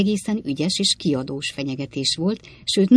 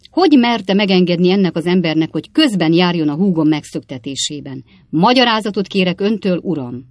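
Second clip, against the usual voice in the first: indignant demand; 3 semitones higher, 7 dB louder than usual. A narrator's reading. Ben demands an explanation.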